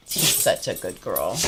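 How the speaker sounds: deep mock-serious praise; low voice. Real, not acted. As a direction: drop low to praise her.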